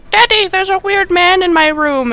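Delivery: put-on a scared little girl calling her dad. Daughter telling her dad about a weird man, in a high child voice. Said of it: "his daughter is like".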